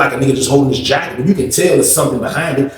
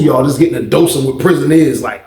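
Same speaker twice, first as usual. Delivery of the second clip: usual speaking voice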